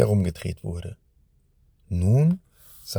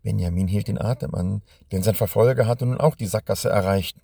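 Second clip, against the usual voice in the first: ordinary delivery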